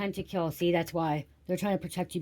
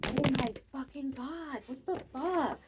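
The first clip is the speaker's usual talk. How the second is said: stunned disbelief; 10 semitones higher, 5 dB quieter, slower than usual. Real, not acted. Shocked by the drawn cards.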